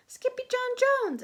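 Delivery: high, loud alarmed call; exclaimed. A narrator's reading. Mama rushing in, exclaiming his name. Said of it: "exclaimed Mama".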